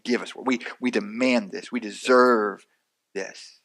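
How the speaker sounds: low, insistent mock demand. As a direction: act out entitled demanding, pitched low. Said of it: "and they're demanding".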